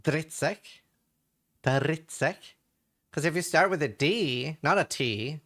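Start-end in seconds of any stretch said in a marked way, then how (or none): 0.0-2.3 dritsek said with a strongly rolled R; rolled R demo. Shown as a demonstration. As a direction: roll the R hard in dritsek. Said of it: "what you need to say is".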